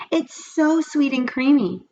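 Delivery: put-on dreamy girl tasting ice cream; delighted girl. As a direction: relish the taste. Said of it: "said Dee Dee".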